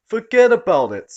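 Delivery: loud, high mock gangster "forget about it"; hammed-up Bugsy Malone mobster. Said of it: "insufferable attempt at sounding like a character from a Bugsy Malone picture".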